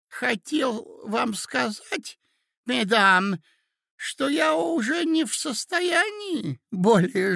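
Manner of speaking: high, halting, slow character voice; doddering old prince. A narrator's reading. Prince explains he cannot marry, high and doddering.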